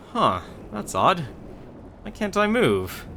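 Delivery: a baffled dark lord, slowly; confused dark lord. Acted.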